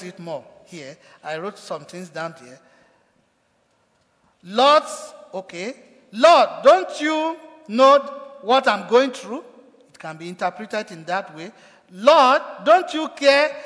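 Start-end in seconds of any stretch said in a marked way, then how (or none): 4.4-4.8 loud high call; frustrated cry. Retold or imitated. Preacher voicing frustrated Martha. 6.1-9.4 loud, high and slow lament; frustrated complaint. Retold or imitated. Preacher voicing frustrated Martha.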